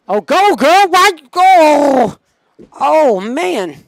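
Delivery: high, loud urging yell; excited cheering. Real, not acted. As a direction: yell the runner on, pitch way up. Cheering on a live play.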